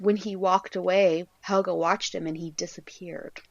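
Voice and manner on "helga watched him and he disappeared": whispered spooky clue. Real, not acted. Whispering the eerie clue about Harbard to friend. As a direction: hush it like a secret.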